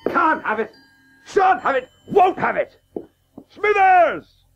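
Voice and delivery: father rages at the selection news; indignant outburst. Acted. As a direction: refuse it, then bark orders. Father outraged at son's news.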